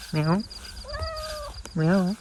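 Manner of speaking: cat meow. Pretend. Meowing back at the cat.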